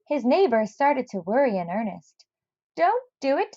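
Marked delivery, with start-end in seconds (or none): 2.8-3.5 put-on high, urgent old man's plea; Mister Barley Bean pleading. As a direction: beg him not to go. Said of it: "said old Mister Barley Bean".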